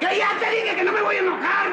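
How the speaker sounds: loud, high-pitched exasperated yell; angry outburst. Acted. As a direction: lose your temper completely, shouting it out. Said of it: "¿Ya ve cómo sí se enojó?".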